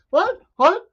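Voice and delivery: high, mock-indignant defense of sharks; indignant guest. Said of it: "He's like".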